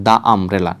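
the umbrella, slow, "the" as "daa"; wrong "da" article. Shown as a demonstration. Teacher warning learners off a pronunciation, "daa umbrella". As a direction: show the mistake, "the" said "daa". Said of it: "Not the umbrella".

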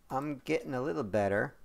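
usual speaking voice

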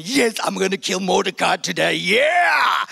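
triumphant shouted gloat; cocky shout of glee; gloating villain. Acted. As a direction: crow it like a villain sure of his win. Haman gloating about killing Mordecai.

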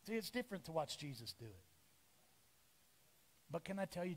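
usual speaking voice

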